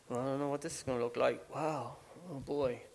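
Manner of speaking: low, soft aside as doubtful bystanders; imitating uneasy onlookers. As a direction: quietly voice the crowd's doubts, slowing at the end. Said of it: "where the people were like".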